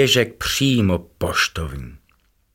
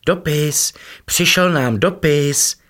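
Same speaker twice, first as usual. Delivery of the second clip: breathless calling; 8 semitones higher, slower than usual. A narrator's reading. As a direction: call out excitedly after running. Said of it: "volal udýchaně". (udýchané volání, výš a hlasitě)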